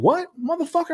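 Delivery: very high-pitched retort; acted angry challenge. Re-enacting his retort as she jumps back in, acted angry challenge.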